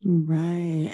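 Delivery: usual speaking voice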